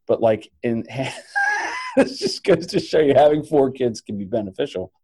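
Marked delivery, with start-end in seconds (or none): 0.9-1.9 sudden high-pitched laugh; high burst of laughter. Real, not acted. Bursting into laughter mid-story.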